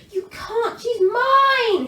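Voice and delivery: furious dragon shout; roaring dragon. A narrator's reading. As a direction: roar it at the knight. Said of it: "he roared".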